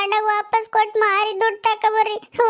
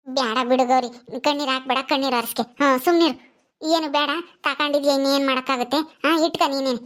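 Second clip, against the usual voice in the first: put-on soothing older mother; 5 semitones lower than usual. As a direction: hush her gently like a village mother. Mother figure comforts Gowri.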